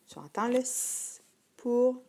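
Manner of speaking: ordinary delivery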